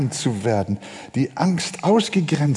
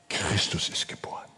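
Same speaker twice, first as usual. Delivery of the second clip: whispered, much softer and lower; hushed reveal; 10 semitones lower, 12 dB quieter than usual. Acted. Preacher revealing the birth, in a whisper. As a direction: breathe it like a secret.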